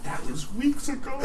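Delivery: high, soft tearful whine; imitated crying. Friend retelling a crying pal's excuse, high soft whimper. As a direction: whimper it in a small mock-sobbing voice. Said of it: "And he's just crying".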